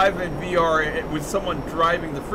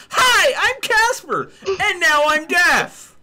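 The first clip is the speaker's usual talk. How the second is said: higher, louder and slower, a yell half laughing; mock deafened yell; 11 semitones higher, 6 dB louder, slower than usual. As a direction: ham up a viewer shouting as if he can't hear himself. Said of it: "and goes".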